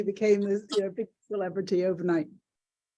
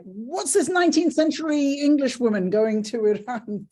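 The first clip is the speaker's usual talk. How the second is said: incredulous; 6 semitones higher, 5 dB louder than usual. Retold or imitated. Voicing her own past puzzlement.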